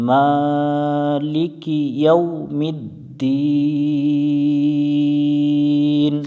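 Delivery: slow, drawn-out chanted recitation; Quranic recitation. Shown as a demonstration. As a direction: chant it slowly like tajweed.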